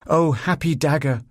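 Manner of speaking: high, slow Shakespearean declaiming; theatrical exclamation. A narrator's reading. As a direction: overplay a tragic recitation. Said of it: "she exclaimed".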